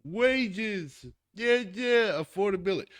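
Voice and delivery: high-pitched whiny mock of complainers; exaggerated mocking outcry; mock screaming. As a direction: mimic people wailing, stretched and high. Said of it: "people who are out there screaming".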